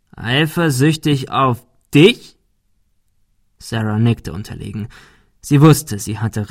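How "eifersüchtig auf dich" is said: slow, incredulous question rising high at the end; mocking disbelief. A narrator's reading. Bully scoffs at the girl's excuse, mocking. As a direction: echo it back with scorn, drawn out.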